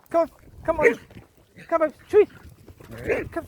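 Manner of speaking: high, slow sing-song coax; high coaxing call; coaxing the dog. Real, not acted. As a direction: lure the dog over sweetly.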